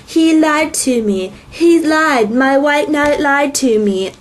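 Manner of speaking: hammed-up wronged maiden; Hymn of None voice. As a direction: intone it slowly, like a solemn chant. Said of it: "back to her Hymn of None voice".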